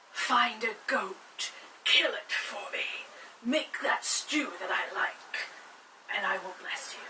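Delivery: put-on slow, breathy old-man whisper; a dying father rasping out his last wishes; frail dying whisper. Dying father asks his son for stew, slow frail whisper.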